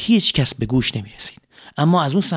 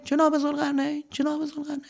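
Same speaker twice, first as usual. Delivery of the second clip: fading, softer echo; fading cave echo; 14 semitones higher, 7 dB quieter than usual. Acted. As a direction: let the name echo away.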